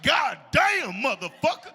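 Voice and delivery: mock bathroom yell. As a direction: bellow it like a guy straining on the toilet. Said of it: "They be in the bathroom like".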